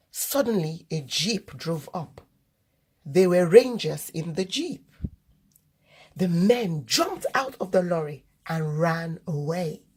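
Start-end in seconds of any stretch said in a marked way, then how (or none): none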